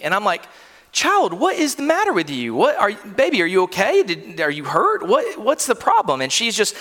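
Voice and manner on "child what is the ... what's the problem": high, tender, anxious pleading; worried father. Retold or imitated. Father asking his sobbing little girl what's wrong, high and tender. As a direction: coax a crying child to say what hurts.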